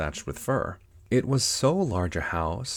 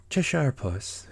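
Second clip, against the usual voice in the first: timid; 7 semitones higher, slower than usual. A narrator's reading. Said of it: "she began rather timidly".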